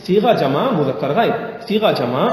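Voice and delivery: slow, each term spaced for the student to repeat; slow recitation of grammar terms; slow dictation. Shown as a demonstration. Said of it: "فعل مضارع مثبت مجہول".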